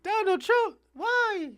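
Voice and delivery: put-on high wailing Cuban-accented plea; mock tearful Cuban lady; imitating her Cuban accent. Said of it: "I can't do the accent".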